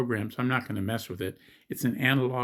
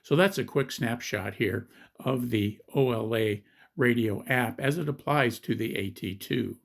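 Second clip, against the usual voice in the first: ordinary talk, nothing marked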